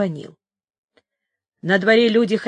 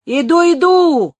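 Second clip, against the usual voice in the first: loud, high, drawn-out call; shouting back; 9 semitones higher, 8 dB louder, slower than usual. A narrator's reading. Benito shouting back to his comrades across the yard. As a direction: holler back to the others. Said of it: "закричал он потом товарищам".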